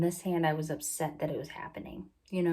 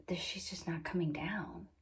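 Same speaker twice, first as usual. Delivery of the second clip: whispered, discouraged nurse's muttering; under her breath; 8 dB quieter than usual. Retold or imitated. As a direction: whisper it as if not meant to be heard. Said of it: "It was, like, under her breath".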